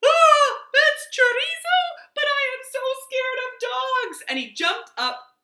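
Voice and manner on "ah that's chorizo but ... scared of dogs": very high, loud frightened yelp; scared yelp. Acted. Cat Chato spotting the dog, shrieking in fright. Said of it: "and yelped".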